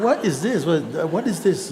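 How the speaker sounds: he reacts to strangers who know him, baffled; bewildered. Retold or imitated. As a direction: throw up your hands in confused disbelief. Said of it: "I said".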